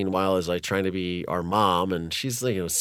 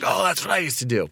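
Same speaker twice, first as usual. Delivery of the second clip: mocking dad's boast; 9 semitones higher, faster than usual.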